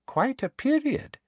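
sugary high-pitched official woman; sweet, prim and very high; prim high Umbridge voice. Acted. Umbridge's voice in a story.

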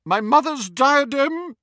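high, loud and flustered; indignant outburst. A narrator's reading. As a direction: blurt it out, losing composure. Ghost's composure slips, angry outburst.

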